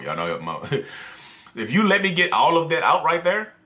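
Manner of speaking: ordinary talk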